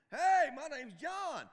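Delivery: loud, high, slow hearty hello; greeting a stranger at church, hearty and loud; cheerful greeting. Acted. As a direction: boom out a friendly introduction. Acting out a greeting.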